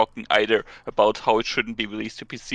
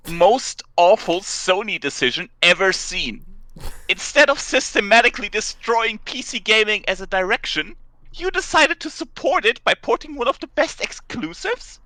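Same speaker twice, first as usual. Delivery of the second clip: mock outraged rant; 10 semitones higher, 5 dB louder than usual. Reading angry forum post aloud.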